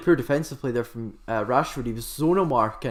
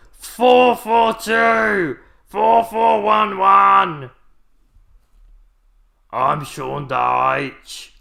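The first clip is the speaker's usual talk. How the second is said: slow, loud, much higher bellowing football manager; Sean Dyche impression; 9 semitones higher, 10 dB louder, much slower than usual. Manager shouting formations from the touchline, slow loud bellow. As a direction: bellow tactics like a gruff touchline boss. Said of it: "just an impression I'm trying to do of him".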